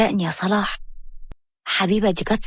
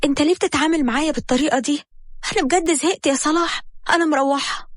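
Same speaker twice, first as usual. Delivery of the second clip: higher, fast, shocked outburst; shocked protest; 7 semitones higher than usual. A narrator's reading. Wife caught at the clinic door, shocked and fast. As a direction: blurt it out fast and pitched up. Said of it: "حبيبة اتصدمت وقالت له".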